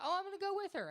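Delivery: put-on high, bubbly girl voice; mock girly voice. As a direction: chirp it like a friend tagging along.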